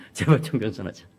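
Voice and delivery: a proud dad laughing at his son's profile line; laughing repeat. Real, not acted.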